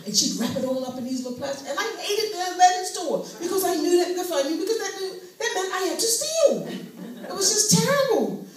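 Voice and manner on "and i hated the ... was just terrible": high, laughing complaint; laughing through it. Real, not acted. To the audience, laughing about stealing food.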